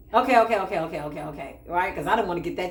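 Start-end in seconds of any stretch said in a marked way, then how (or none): 0.1-0.8 louder, rapid eager okays; frantic okays. Retold or imitated. Retold frantic agreement, then quiet repeat. 0.8-1.6 lower, much softer okays; resigned quiet okays. Retold or imitated. Same okays repeated, dropped low.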